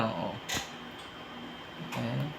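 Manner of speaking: ordinary talk, nothing marked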